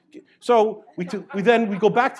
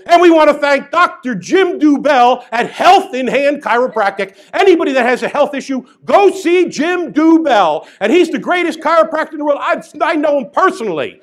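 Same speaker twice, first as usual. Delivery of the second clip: announcer voice; 8 semitones higher, 10 dB louder than usual. Retold or imitated. On the microphone praising himself, loud hammed-up announcer. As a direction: boom it out like a fairground MC.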